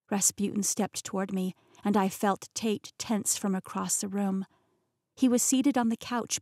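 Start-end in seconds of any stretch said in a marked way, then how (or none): none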